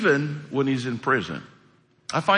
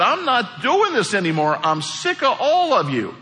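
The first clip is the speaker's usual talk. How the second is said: fed-up outburst; 8 semitones higher, 4 dB louder than usual. Retold or imitated. Joseph's imagined outburst.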